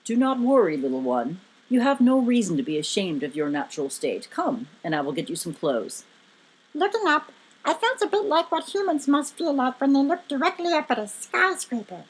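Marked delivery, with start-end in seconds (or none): 0.0-6.0 low, measured booming giant. A narrator's reading. Booming giant reassuring a little stranger, deep and measured. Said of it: "The booming voice was laughing".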